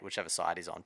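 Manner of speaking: ordinary talk, nothing marked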